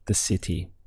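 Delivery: ordinary talk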